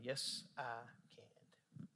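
slow, barely audible whisper; humbled whisper. Retold or imitated. Pastor yielding to the Holy Spirit, whispering. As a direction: surrender it in a hushed whisper.

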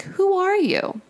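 high-pitched mock child; imitated child's voice. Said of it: "A child's voice can be heard saying".

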